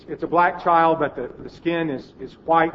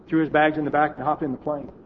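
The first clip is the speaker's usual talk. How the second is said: ordinary talk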